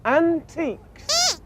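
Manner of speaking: antiques read out slowly and drawn out, high; slow reading aloud. Shown as a demonstration. Reading a shop sign slowly to Sweep. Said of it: "it says up there".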